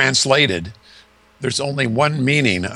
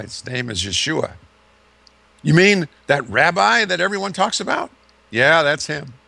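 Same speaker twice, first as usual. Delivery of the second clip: jailer and prisoner dialogue; 4 semitones higher than usual. Retold or imitated.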